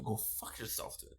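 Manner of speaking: voiceless whisper of the insult; a sly whispered translation; whispered aside. Pretend. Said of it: "So you tell them".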